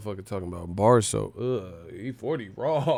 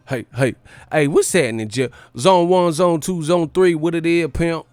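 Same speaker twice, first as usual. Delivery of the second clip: loud, high hype-man shout-outs; hyped rapper greeting; 6 semitones higher, 7 dB louder than usual. Retold or imitated. Hyping the crowd with shout-outs, high and loud. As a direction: yell the zones out like a rapper on stage.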